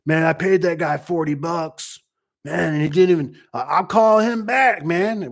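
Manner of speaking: hammed-up annoyed customer; complaining customer. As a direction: grumble like a customer who got ripped off. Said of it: "you can be like".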